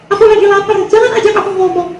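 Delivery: put-on high, grumpy girlfriend voice. Hungry girlfriend snapping at her boyfriend, high grumpy voice.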